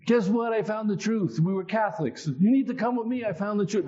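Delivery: high, bursting with excitement; excited announcement. Retold or imitated. Young convert excitedly telling his parents the news. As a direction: announce it like you've found treasure.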